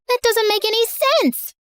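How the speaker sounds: put-on high, indignant kid voice; child's high-pitched objection; exasperated kid. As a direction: protest like a fed-up little girl. Kid arguing with con man.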